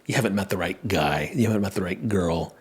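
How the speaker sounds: low, soft mock quoting of a dismissive line; mock dismissive quote. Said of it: "rather than just saying".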